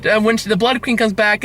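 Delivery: ordinary delivery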